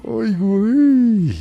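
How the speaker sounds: long, high, loud ay güey; drawn-out exclamation. Real, not acted. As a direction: stretch it out in dismay. Reacts to Cruz Azul schedule. (exclamación alargada y aguda)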